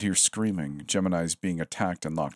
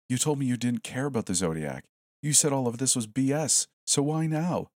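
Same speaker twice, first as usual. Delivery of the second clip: higher and higher, pleading; pleading, rising pitch; 7 semitones higher than usual. Acted. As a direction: voice climbing higher with disbelief. Smith pleads with frightened friend.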